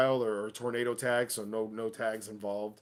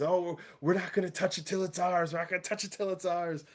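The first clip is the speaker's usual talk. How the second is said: a hint of a higher, teasing Woods voice; mildly higher, sing-song taunt; 6 semitones higher than usual. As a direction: lightly tease that you won't touch it. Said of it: "And he's".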